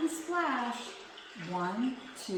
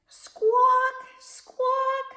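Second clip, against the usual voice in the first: bird squawk; 12 dB louder than usual. Acted. Bird bugs flying.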